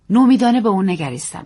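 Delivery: ordinary delivery, nothing marked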